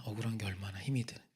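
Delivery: whispered, fast and soft; hushed empathy. Real, not acted. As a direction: lean in and whisper it.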